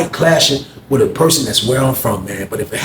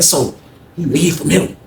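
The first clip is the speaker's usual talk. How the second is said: ordinary talk, nothing marked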